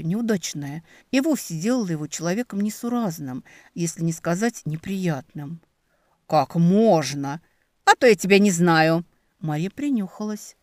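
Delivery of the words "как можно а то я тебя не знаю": indignant exclaim. Acted. Marya scolds teasingly.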